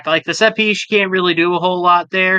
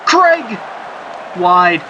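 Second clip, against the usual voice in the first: excited call. Real, not acted. Commentator shouting a late chance for the viewers, then the miss. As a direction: yell the name as the shot flies.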